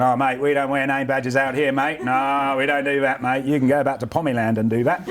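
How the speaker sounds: drawn-out mock Australian accent. Businessman mimicking sceptical convention crowd, Australian accent. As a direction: put on a slow, matey Australian twang. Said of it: "your voice changes".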